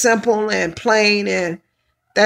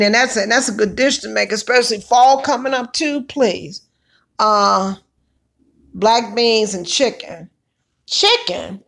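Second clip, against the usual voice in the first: ordinary talk, nothing marked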